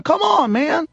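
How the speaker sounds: high-pitched imitation of a plea; mock pleading. Man begging the cop in the video, high pleading. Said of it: "He's pleading".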